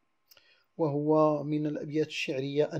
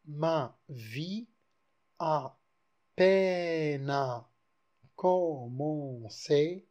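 slow verse reading with pauses between words; poem recital; much slower than usual. Shown as a demonstration. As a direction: recite the decasyllable slowly and tenderly. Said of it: "en voilà un".

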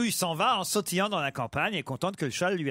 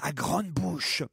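snake voice; 4 semitones lower, 5 dB quieter than usual. Pretend. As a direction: let it slither out. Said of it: "tu fais le serpent".

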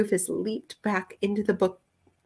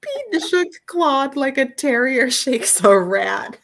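higher, slow, laughter-choked narration; laughing while reading; 6 semitones higher, 3 dB louder, much slower than usual. Real, not acted. Reader cracking up at Claude being shaken, real laughter. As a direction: struggle through the sentence as giggles take over.

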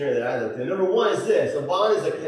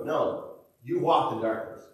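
ordinary talk, nothing marked

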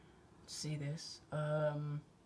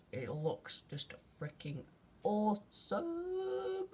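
awed; 7 semitones higher, much slower than usual. Real, not acted. Awed by the blood moon.

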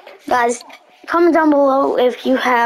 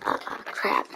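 under the breath, no voice; whispered curse; 12 dB quieter than usual. Real, not acted. Whispered curse after a mistake.

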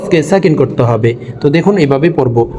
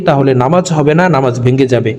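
ordinary delivery

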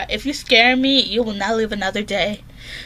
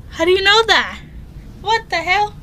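shocked disbelief; 9 semitones higher than usual. Real, not acted. Girl stunned the app knows her, shocked. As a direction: demand it in alarm.

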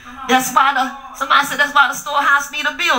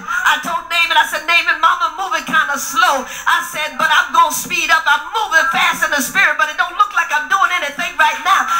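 preaching at full force; 4 semitones higher than usual. Acted. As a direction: whoop it up to the congregation. Preaching over music.

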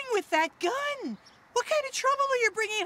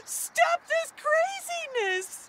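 higher, crying voice begging him to stop; tearful plea; 7 semitones higher than usual. Acted. Mother begging armed son to stop, crying. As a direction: sob it out at your son.

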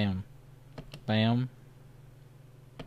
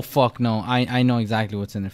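usual speaking voice

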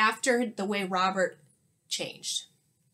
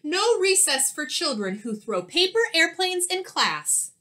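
louder, higher roared scolding; roaring teacher; 7 semitones higher, 4 dB louder than usual. Acted. Teacher roaring a punishment at the class, angry. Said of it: "Miss Kirby roared".

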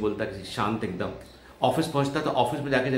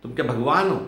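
incredulous retort; 7 semitones higher, 5 dB louder than usual. Retold or imitated. Man retorts to stranger.

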